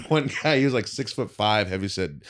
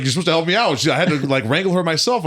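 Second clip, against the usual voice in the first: raised, higher retold grievance; exasperated complaint; 9 semitones higher, 6 dB louder than usual. Big bouncer scolding his partner, exasperated. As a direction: complain loudly, imitating the partner.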